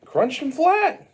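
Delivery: high, loud, drawn-out exclamation; emphatic repeat shout. Acted. Storyteller relishing Mario's stomp on Luigi, high emphatic shout. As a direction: hammer it out, slow and high.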